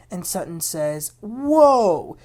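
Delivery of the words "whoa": sudden high, loud "whoa" of retold shock; startled whoa. Sutton hit with Erica's accusation, loud surprised whoa.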